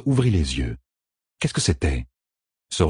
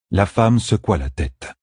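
ordinary delivery, nothing marked

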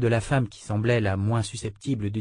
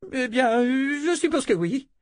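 whiny voice. Acted. Noblewoman reluctantly agrees.

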